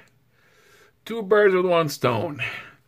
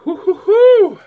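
high loud hoo hoo hoo from the heat; pained hooting; 9 dB louder than usual. Real, not acted. Vlogger hooting at the spicy burn, high and loud. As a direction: hoot out the burn. Spicy food burning mouth.

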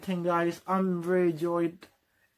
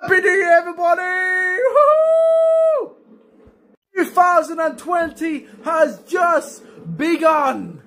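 high loud celebratory shouting; new year countdown crowd; 6 semitones higher, 8 dB louder than usual. Real, not acted. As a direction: scream it out in celebration.

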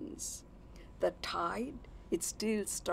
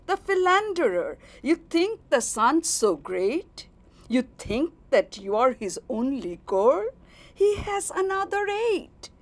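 loud, high, jealous outburst; bellowing; 6 semitones higher, 7 dB louder than usual. A narrator's reading. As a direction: roar it at her, stung and high. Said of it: "I bellowed".

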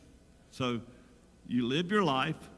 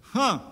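ironic huh; 6 semitones higher, 7 dB louder than usual. Real, not acted. Ironic reaction to scripture.

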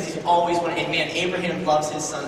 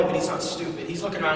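usual speaking voice

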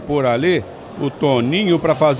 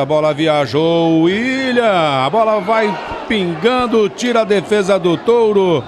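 rising excitement; 5 semitones higher than usual. Real, not acted. Dangerous free kick in the box.

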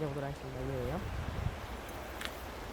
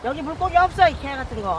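frustrated outburst; 6 semitones higher than usual. Real, not acted. Fishing vlogger cursing the empty spot, frustrated outburst. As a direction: snap at the water in frustration. Angler venting at empty water.

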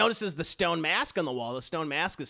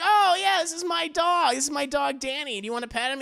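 higher, eager happy voice; cheerful boy; 8 semitones higher than usual. Retold or imitated. JoJo introducing his dog, higher happy voice. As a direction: proudly show off the dog.

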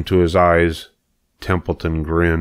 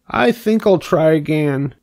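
cheerfully; 9 semitones higher than usual. Acted. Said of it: "said Wilbur cheerfully".

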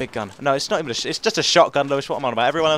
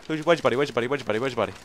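normal talking voice

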